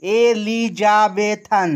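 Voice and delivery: very slow, each syllable drawn out on a level pitch; slow teacherly syllable-by-syllable demonstration. Shown as a demonstration. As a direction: stretch every syllable evenly so it can be counted. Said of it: "তিনের বেশি syllable থাকে".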